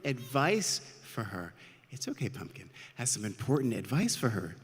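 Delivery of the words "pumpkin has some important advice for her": plain reading voice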